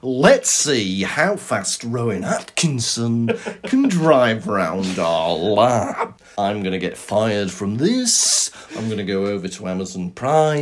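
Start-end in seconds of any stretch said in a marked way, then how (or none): 0.0-8.5 low, slow presenter drawl; mock car-show presenter. Host announcing his next stunt and exit, low parody drawl. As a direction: sink lower and drag out the phrases.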